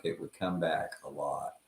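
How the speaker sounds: ordinary talk, nothing marked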